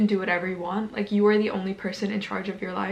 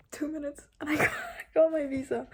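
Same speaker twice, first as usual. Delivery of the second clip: tearful; 5 semitones higher, 4 dB quieter than usual. Real, not acted. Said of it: "crying a little bit".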